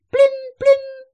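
high, loud, piercing double cry. A narrator's reading. The sheep cries out to Alice at the oars, shrieking. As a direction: shout it twice like a shrieking sheep character. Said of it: "cria encore la brebis".

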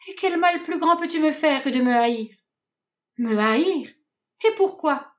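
high, pained, incredulous pleading; hurt disbelief. Acted. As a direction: ask it like the betrayal just landed. Hermia blessée, indignée. (incrédulité blessée)

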